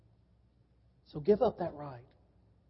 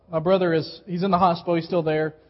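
ordinary talk, nothing marked

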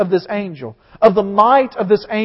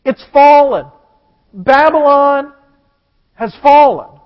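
put-on loud, "Babylon" drawn slow; excited news; 5 semitones higher, 5 dB louder, slower than usual. Shown as a demonstration. Preacher repeating the angel's cry, excited and loud. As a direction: announce it like thrilling news. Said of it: "the most exciting news, you repeat yourself".

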